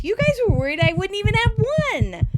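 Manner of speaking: high, loud, fast indignant surprise; shocked. Retold or imitated. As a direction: blurt it out, stunned. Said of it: "Phoebe is shocked".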